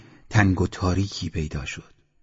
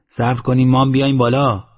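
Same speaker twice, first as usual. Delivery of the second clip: calling up; 4 semitones higher, 7 dB louder than usual. Acted. Calling up to men on wall.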